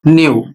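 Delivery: न्यू said as "nyoo", y glide before long u; model pronunciation. Shown as a demonstration. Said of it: "इसका उच्चारण होगा". (आदर्श उच्चारण: न्यू, "न्यू" की तरह)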